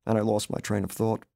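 usual speaking voice

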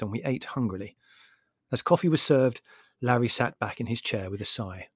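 ordinary delivery

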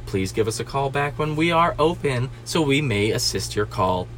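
low, soft recorded-message voice; fake voicemail greeting. Restaurant worker dodging a caller, pretend recorded greeting. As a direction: recite it like an answering machine, quiet and low. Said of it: "I'm voicemailing from now on".

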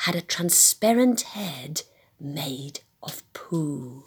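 spooky whisper. Acted. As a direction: whisper it like a secret.

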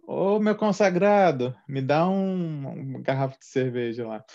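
hammed-up boteco customer ordering a beer; Brazilian bar regular. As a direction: hail the bartender like an old pal. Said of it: "igual você chega num boteco no Brasil, a galera faz".

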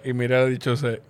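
ordinary delivery

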